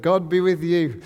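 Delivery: loud group-style answer in a changed voice; staff chorus reply. Pretend. Coworkers answer the boss, loud chorus reply. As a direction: answer back like the whole room at once. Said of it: "everybody stands up and goes in".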